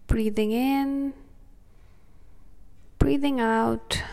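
soothing, unhurried breathing cues; calm meditative tone. Acted. Leads a guided meditation.

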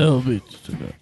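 a hint of a stuffy brooding hero; Ben Affleck with a cold. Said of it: "isso aí é o Ben Affleck com gripe". (leve imitação do Ben Affleck gripado)

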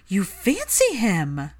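slow, stunned question; astonished, drawn-out question; shocked. Acted. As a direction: gasp it out in disbelief. Hermione shocked by Pansy's crush.

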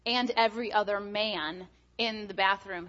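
usual speaking voice

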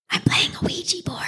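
hushed secret whisper; whispered quote. Retold or imitated. Retelling a sister's secret, whispered. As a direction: breathe it like a kid hiding. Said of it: "She probably said it, like, cuter".

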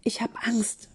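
very soft, fearful whisper. A narrator's reading. Charlotte confessing fear to Ben, tiny whisper. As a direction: whisper your fear almost soundlessly. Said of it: "flüsterte Charlotte".

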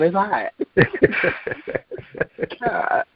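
high bursts of laughter between words; can't stop giggling; laughing fits. Real, not acted. Still laughing after the poem.

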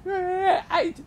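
high tearful squeak; mock crying kid. Kid caught cheating, high tearful whimper. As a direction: break into fake tears. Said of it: "And I was like".

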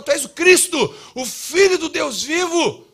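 high, loud, slow proclamation; exclamação de Pedro. Retold or imitated. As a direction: shout it out as Peter's bold declaration. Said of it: "o Pedro salta e fala". (brado proclamado de Pedro, alto e agudo)

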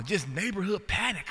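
ordinary talk, nothing marked

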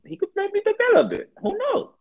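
musing in falsetto; high falsetto. Pretend. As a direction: float it up in falsetto. Mock hopeful musing on Tatum.

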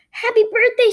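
high loud excited cheer; energetic scream. A narrator's reading. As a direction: shout it full of energy. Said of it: "she screams in her very energetic voice".

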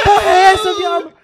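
high loud shocked cry; shocked shout. Real, not acted. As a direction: yell it in shock. Shocked exclamation over story.

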